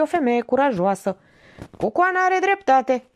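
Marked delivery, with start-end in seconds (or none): 1.8-3.0 high-pitched jovial cry; cheerful exclamation. A narrator's reading. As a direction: exclaim it in high good humour. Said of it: "exclamă bine dispus Drojdioară". (exclamație veselă, pe ton înalt)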